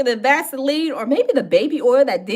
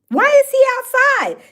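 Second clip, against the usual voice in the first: higher, louder disbelieving why; incredulous exclaim; 9 semitones higher, 5 dB louder than usual. Real, not acted. Asking the chat why he is outside, in loud disbelief. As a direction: throw the question out in disbelief.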